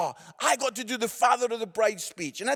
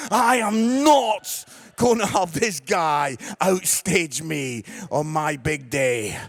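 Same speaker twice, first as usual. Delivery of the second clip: slow, emphatic defiance, dropping lower at the end; mock indignation; 6 semitones lower, 5 dB louder, slower than usual. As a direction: refuse as a jealous show-off would, word by word. Mock-jealous father refusing to be outdone.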